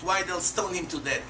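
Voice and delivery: ordinary talk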